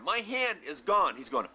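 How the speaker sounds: ordinary delivery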